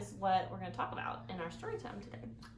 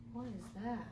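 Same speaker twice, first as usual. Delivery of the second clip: ordinary delivery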